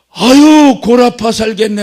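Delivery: a loud, high, fed-up cry; the preacher shouts his positive version of a complaint; exasperated shout. Retold or imitated. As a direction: yell it out in frustration. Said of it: "그랬더니 한 방에 나가더라고".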